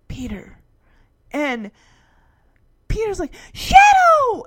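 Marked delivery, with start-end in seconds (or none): 0.1-0.6 low, hushed name; soft recognition. Retold or imitated. Shadow's eyes lock with Peter, hushed and low. 3.5-4.4 high, loud cry of the name; joyful shout. Retold or imitated. Peter spots Shadow returning, high joyful shout.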